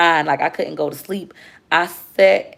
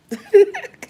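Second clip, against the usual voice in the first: high laugh; sudden high laugh. Real, not acted. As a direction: break into laughter.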